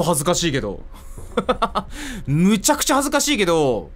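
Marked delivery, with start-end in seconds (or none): none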